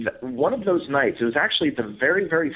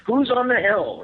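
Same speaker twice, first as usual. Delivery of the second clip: high, loud repeated call; calling up the hill once more; Matt calling again; 13 semitones higher, 4 dB louder than usual. Retold or imitated. Matt shouting again at an unseen hill figure, high and loud. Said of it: "Matt saying something".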